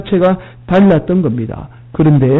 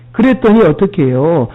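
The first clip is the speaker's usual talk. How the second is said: ordinary delivery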